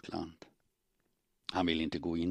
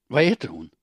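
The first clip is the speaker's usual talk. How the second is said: sharp, loud demand; snapping jealous question; 5 semitones higher, 11 dB louder, faster than usual. Acted. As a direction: demand the rival's name. Jealous woman demands rival's name.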